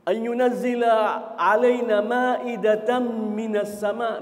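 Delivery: slow, loud Quranic chant; slow loud recitation of the verse. Acted. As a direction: intone the verse slowly, full voice.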